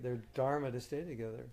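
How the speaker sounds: ordinary talk, nothing marked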